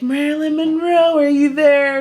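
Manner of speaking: high, mock-spooky call into the void; mom calling spirit. Mom calling out to Marilyn on the Ouija board, high and hammy. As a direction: summon the ghost like a séance host.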